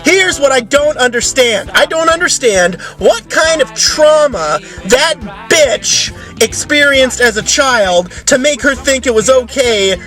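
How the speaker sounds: usual speaking voice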